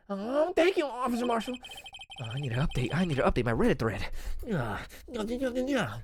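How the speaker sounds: high-pitched put-on fangirl voice; mocking nerdy girl. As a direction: larp as an over-eager nerdy girl. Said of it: "that's how she sounds".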